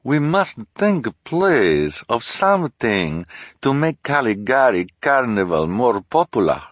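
put-on high, loud foreign accent; Madame Lulu's strange accent. A narrator's reading. Fortune-teller reproaches Olaf over money, in a strange accent. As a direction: protest in a thick foreign accent. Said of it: "said in her strange accent".

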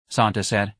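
usual speaking voice

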